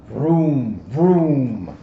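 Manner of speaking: loud engine noise; motorcycle vroom. Acted. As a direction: rev it like a motorbike. Imitating motorcycles.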